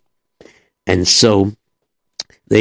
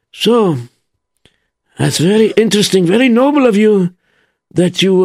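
the wise Rebbe; 7 semitones higher than usual. Acted. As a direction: ponder aloud, unhurried. The Rebbe voiced, slow.

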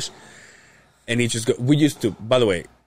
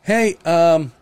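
high, hesitant caller voice; imitating a high-voiced man on the phone; Jerry; 5 semitones higher than usual. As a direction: stumble through an introduction, pitched up. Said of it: "Doesn't he have the high voice?".

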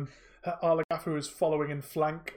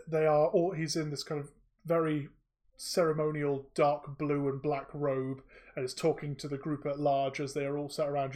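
ordinary talk, nothing marked

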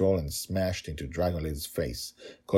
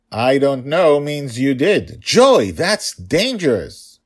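louder, higher stern father, slow on the warning; stern scolding; 7 semitones higher, 10 dB louder, slower than usual. Acted. Father scolding his daughter for getting hurt, stern and loud. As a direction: scold her firmly, every word weighted.

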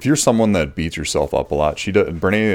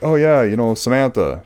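mock breezy "Oh, yeah, you know, Samantha" pitched higher and louder; breezy confident self; 8 semitones higher, 5 dB louder than usual. Husband to wife, confidently getting a name wrong, in a bright, higher, louder mock voice. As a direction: play his own cheerful certainty about the wrong name. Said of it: "when I'll be like".

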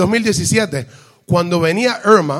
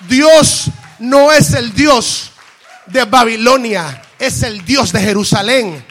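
loud, slow, drawn-out preaching shout; emphatic proclamation; 3 semitones higher, 5 dB louder, slower than usual. Real, not acted. Preacher declaring God of Jerusalem to congregation, forceful proclamation. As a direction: proclaim it slowly, hitting every phrase hard.

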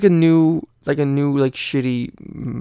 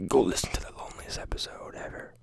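unvoiced aside, whispered close to the mic; a teasing whispered plug for the episode; 10 dB quieter, faster than usual. Real, not acted. Aside to absent friend Alex.

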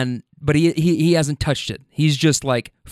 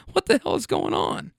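high, softer incredulous squeal; acted disbelief; 10 semitones higher, 5 dB quieter than usual. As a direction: ask it in baffled, high-pitched exasperation. Baffled driver after being sneezed on.

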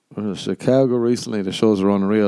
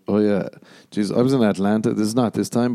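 ordinary delivery

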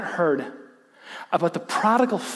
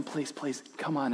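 a soft, much quieter plea; soft pleading; 3 semitones lower, 12 dB quieter than usual. Retold or imitated. A hint of a father quietly pleading with his son outside. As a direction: beg him gently, please. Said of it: "dad is pleading with him".

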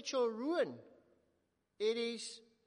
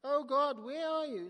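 loud, raised anguished cry; crying out to God; 5 semitones higher, 8 dB louder than usual. Acted. God's people crying out in ruined Jerusalem, loud anguished plea. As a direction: throw the plea up to heaven. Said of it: "crying out".

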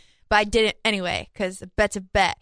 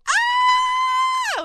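very high squeal of delight; mom's excited scream; 4 dB louder than usual. Retold or imitated. Imitating mom cheering at the game, high squeal. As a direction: shriek like a cheering mom with pom-poms. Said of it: "my mom's like".

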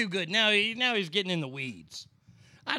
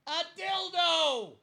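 high, slow, shouted punchline; yelled across room; 14 semitones higher, slower than usual. Retold or imitated. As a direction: yell it across the office. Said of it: "somebody's gonna yell across the room".

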